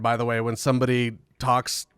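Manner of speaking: ordinary talk, nothing marked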